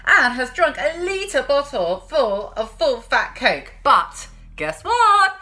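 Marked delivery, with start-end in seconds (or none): 3.8-5.3 high, slow playful guess what; playful storyteller tease; teasing build-up. A narrator's reading. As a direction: tease the kids before the punchline.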